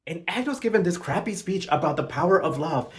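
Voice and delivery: ordinary delivery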